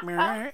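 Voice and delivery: high imitated trumpet toot; little trumpet sound. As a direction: mimic a tiny trumpet ending a jingle. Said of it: "like, a little trumpet sound".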